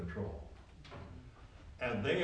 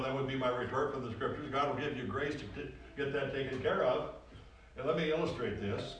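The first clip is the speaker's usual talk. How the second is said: ordinary talk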